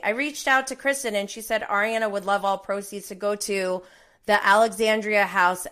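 usual speaking voice

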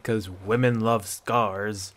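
slow, wry joking tone. Acted. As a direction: drawl it out as a joke. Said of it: "the hunter jokingly recalled".

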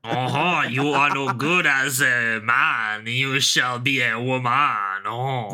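a pompous French decree, drawn out; mock French hypnotist.

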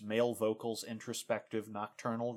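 ordinary delivery

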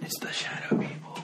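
breathless, voiceless whisper, teasing; spooky whisper. Pretend. Host joking about a strange noise, spooky whisper. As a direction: whisper it like a ghost story.

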